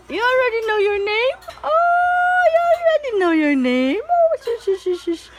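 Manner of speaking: high excited cooing. Real, not acted. Cooing then hushing baby.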